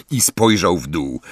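plain reading voice

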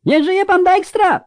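very high, loud cheer; toady's roar. A narrator's reading. Bootlicker in the crowd hails the powerful minister, roaring. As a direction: bellow praise to flatter the boss. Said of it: "ryknął jakiś wazeliniarz".

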